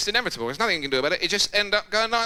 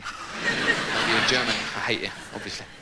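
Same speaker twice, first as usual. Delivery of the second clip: mock hatred; 9 semitones lower, 3 dB quieter, slower than usual. Mock hateful muttering at German.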